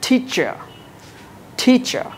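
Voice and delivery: টিচার with a puff on T; English teacher; aspirated teacher. Shown as a demonstration.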